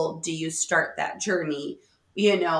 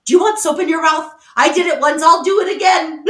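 mock threat; 8 semitones higher, 9 dB louder than usual. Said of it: "I was like".

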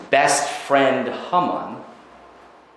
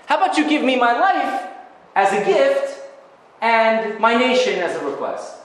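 pleading Esther; 12 semitones higher than usual. Retold or imitated. Esther pleading voiced to king.

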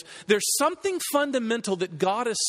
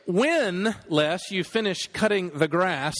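When set apart and drawn out, then a pause; stress on When; slower than usual. Retold or imitated. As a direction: lean hard on "When" before going on. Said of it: "Emphasis on the word when".

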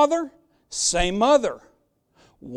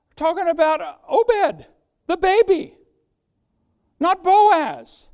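much higher, ringing emphasis; excited revelation; 8 semitones higher, 4 dB louder than usual. Real, not acted. Preacher revealing the baby is redeemer, excited and high. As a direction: reveal it like a surprise.

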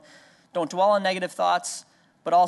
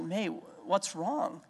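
gentle question; 7 dB quieter, much slower than usual. Retold or imitated. Father asks crying toddler.

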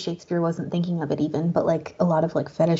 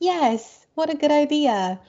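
sarcastic; 8 semitones higher, slower than usual. Retold or imitated. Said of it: "I was like".

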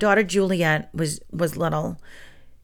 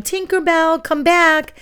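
calling out; 10 semitones higher, slower than usual. Retold or imitated. Class calling the fairy.

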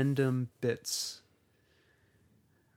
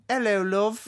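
high, badly faked Cockney; bad Cockney accent; 8 semitones higher, 4 dB louder than usual. Shown as a demonstration. Host retrying for unconvinced co-host, bad Cockney. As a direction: have another go at Cockney charm. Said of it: "that's the worst Cockney accent".

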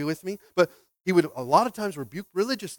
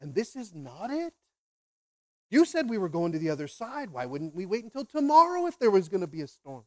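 higher-pitched, acted protest; indignant complaint; 6 semitones higher than usual. Disciple protesting to Jesus about the storm, indignant complaint. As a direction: play an aggrieved follower questioning his leader.

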